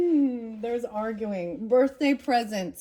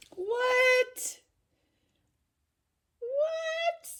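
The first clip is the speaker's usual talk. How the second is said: very high, stunned what; stunned squeak. Real, not acted. To viewers at the book's pick, stunned squeak. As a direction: squeal it in disbelief.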